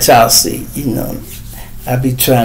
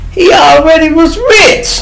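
exasperated high cry; 4 dB louder than usual. Real, not acted. Vlogger venting over a friend's trial, voice cracking high. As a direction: voice cracks way up in disbelief. Ranting about [name]'s case.

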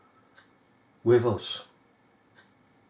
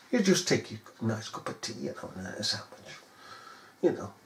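quiet aside; 6 semitones lower, 12 dB quieter, faster than usual. Real, not acted. Softened aside.

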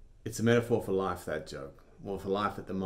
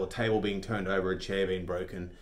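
ordinary talk

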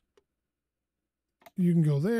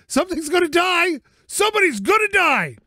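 high, loud furious yell; real frustrated shout, pitched way up; 11 semitones higher, 5 dB louder than usual. Real, not acted. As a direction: roar it out at the stubborn car part. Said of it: "I couldn't be any more angry".

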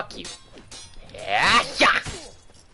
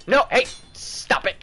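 ordinary talk, nothing marked

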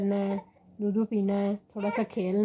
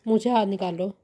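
usual speaking voice

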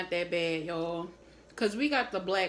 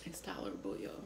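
whispering; 4 semitones lower, 13 dB quieter, faster than usual. Real, not acted. Aside while eating spicy noodles.

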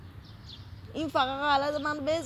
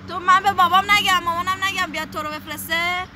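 raised voice threatening to call her dad; a mock-defiant yell at the captor; loud threat; 4 semitones higher, 6 dB louder than usual. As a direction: shout it back at him, defiant. Girl threatens captor with her father.

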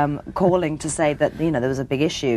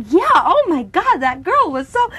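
high, loud, drawn-out complaint; exasperated outburst; 12 semitones higher, 5 dB louder than usual. Retold or imitated. Producer venting about the fake agent, exasperated. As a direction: imitate someone venting over a nasty caller. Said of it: "She was like".

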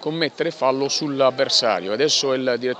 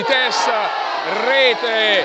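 breathless italian goal commentary; 6 semitones higher, much slower than usual. Real, not acted. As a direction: yell it out like a goal call. Goal scored, live commentary.

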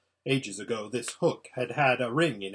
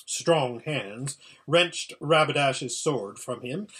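ordinary delivery, nothing marked

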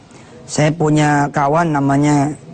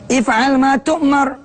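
higher, slow chanted Arabic phrase; Quranic recitation; 9 semitones higher, much slower than usual. Acted. Preacher recites Ismail's answer, higher and slowly chanted. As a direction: draw out tu'mar in recitation.

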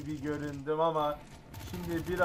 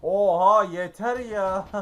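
exasperated laugh; 3 semitones higher, 22 dB louder, slower than usual. Real, not acted. Gamer exasperated then laughs.

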